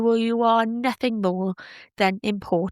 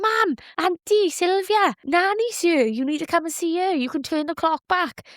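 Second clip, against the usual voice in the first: much higher, calling urgently to mum and auntie; calling her mum and auntie to see her nan, much higher and eager; excited calling out; 8 semitones higher than usual. Retold or imitated. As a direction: come see, she's here. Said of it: "and I was like".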